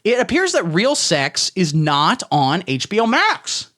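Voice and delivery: ordinary talk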